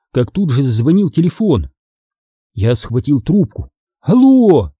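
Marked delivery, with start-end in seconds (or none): 4.0-4.7 high, slightly winded hello; acted breathless. A narrator's reading. Boy grabs ringing phone, mildly breathless. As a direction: answer hurriedly, a touch breathless. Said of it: "всё ещё задыхаясь".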